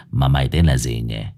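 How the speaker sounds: low, smug boss question; gang boss. A narrator's reading. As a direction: toy with the victim. Boss asks name.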